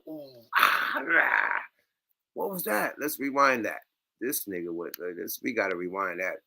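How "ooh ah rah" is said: mock grunting. Imitating the guest's grunts from the clip, mock. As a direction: grunt it like a coked-up outburst.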